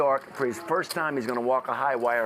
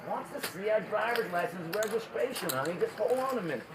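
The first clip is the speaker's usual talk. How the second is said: raised, high pitch for deaf ears; old husband repeating; 8 semitones higher, 4 dB quieter than usual. Acted. Old husband relays cop's request to wife, high strained patience. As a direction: spell it out high for a hard-of-hearing wife.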